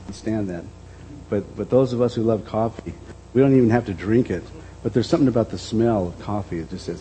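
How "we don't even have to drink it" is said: ordinary talk, nothing marked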